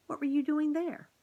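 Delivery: high, loud amazed question; surprised question. A narrator's reading. Garf asking the mouse, high and amazed. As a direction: blurt it out in disbelief.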